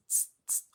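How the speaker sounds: soft voiceless "tss" hiss; short T released into S, repeated; quiet teacher's demo of a "tss"; isolated tss sound. Shown as a demonstration. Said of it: "we have the tss".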